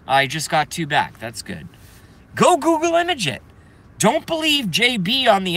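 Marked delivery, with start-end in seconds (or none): 2.3-5.6 high, loud, urgent insistence; practically shouting the order at viewers; pushing the audience to look it up now; excited high urging. Real, not acted. Repeating the urgent demand.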